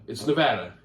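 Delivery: Nevada with the middle vowel of "cat"; "Nevada" corrected to the short A of "dad"; correct local pronunciation. Shown as a demonstration. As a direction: insist on "Nevada" as nuh-VAD-uh. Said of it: "not Nevada".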